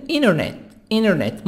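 "Internet" said inernet, T after N dropped; silent T. Shown as a demonstration. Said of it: "T silent".

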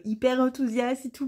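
ordinary talk

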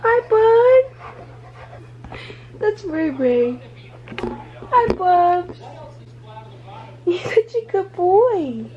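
high, sing-song pet voice; doggy talk. Real, not acted. Woman greeting her dog in a high pet voice. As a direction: coo at the dog.

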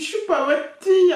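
réplique aiguë et forte, secouée de rire; livreur imité en riant. Retold or imitated. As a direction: imite le livreur vexé en riant. (voix aiguë mi-rire mi-cri)

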